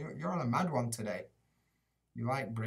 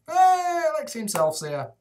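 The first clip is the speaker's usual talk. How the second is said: high, loud hey for a new arrival; light up as he spots a regular; excited greeting; 14 semitones higher, 9 dB louder than usual. Real, not acted. Streamer spotting a favourite viewer in chat, greeting him with a high, loud hey.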